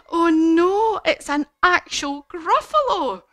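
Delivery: hammed-up gasping terror, high and loud; frightened mouse. Mouse spotting an actual Gruffalo, panicked cry. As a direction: cry out in mock fright, building to a long wail.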